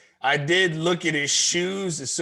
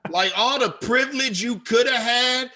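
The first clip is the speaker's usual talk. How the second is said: heated rant; 6 semitones higher, 4 dB louder than usual. Retold or imitated. Ranting at the man.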